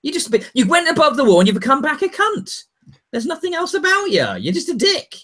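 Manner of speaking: mocking the crowd's contempt; mock scornful crowd. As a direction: sneer at him like a jeering mob. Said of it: "everybody's just like".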